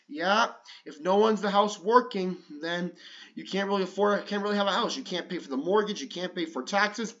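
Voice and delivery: plain reading voice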